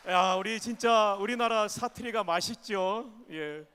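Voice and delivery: ordinary delivery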